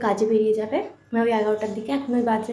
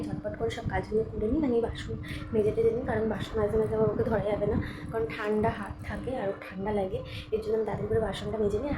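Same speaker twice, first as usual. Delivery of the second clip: much softer, hushed voice; speaking softly; 6 dB quieter than usual. Real, not acted. Explaining chores to viewers while the baby sleeps, hushed. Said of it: "আস্তে কথা বলছি".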